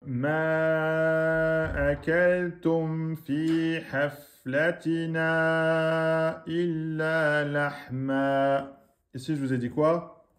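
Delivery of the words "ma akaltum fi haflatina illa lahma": slow tajwid recitation stretching the long vowel in akaltum; slow Quran recitation. Shown as a demonstration. Teacher demonstrates the four-beat madd to students, recited slowly. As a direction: chant Ma akaltum fi haflatina slowly, holding each madd. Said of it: "règles de tajwid".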